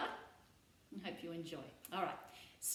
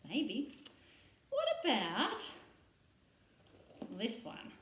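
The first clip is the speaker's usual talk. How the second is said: ordinary talk, nothing marked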